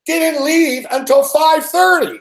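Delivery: high, drawn-out exasperation; exasperated. Real, not acted. Passenger complaining about late departure, exasperated. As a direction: stretch it out in high-pitched disbelief.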